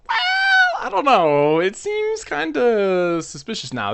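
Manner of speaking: high, loud mock-spooked outcry, dragged slow; mock alarmed shout. Son egging on the haunting fears, loud high shout. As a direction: yell it like you're sure she's a ghost. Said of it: "And I was like".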